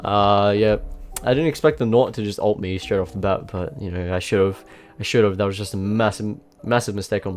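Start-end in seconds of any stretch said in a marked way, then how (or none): none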